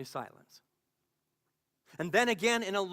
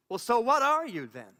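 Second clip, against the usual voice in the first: loud, higher-pitched demand; exasperated challenge; 6 semitones higher, 7 dB louder than usual. Acted. Troubled listener challenging the voice of God, exasperated and loud. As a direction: throw the question back impatiently.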